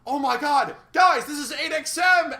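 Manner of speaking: high, loud thrilled shriek; imagined gem's loud, high excited cry; excited squeal. Retold or imitated. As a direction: squeal it with joy like a fan meeting a hero. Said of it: "She's like".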